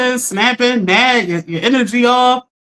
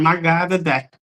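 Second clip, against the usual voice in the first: lower, drawn-out exclamation; mom's catchphrase; 6 semitones lower, 4 dB quieter, slower than usual. Retold or imitated. Host imitating her mom's favorite line to viewers, a touch lower. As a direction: say it the way mom always says it. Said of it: "That's my mom's favorite line".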